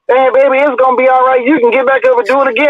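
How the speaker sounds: high, tender imitation of mom; comforting mom. Son imitating his mom comforting him after a lost fight, high tender mom voice. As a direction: soothe like a mother to her beaten son.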